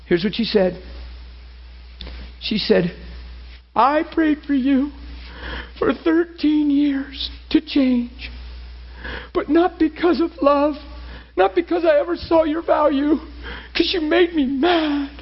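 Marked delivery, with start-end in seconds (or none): 3.8-15.1 high, impassioned crying imitation; high-pitched, emotional retelling of a weeping wife; retold tearful confession. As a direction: pour out her confession as if through tears. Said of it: "gasping for breath and crying".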